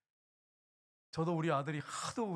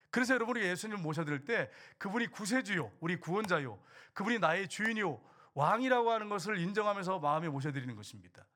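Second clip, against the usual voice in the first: usual speaking voice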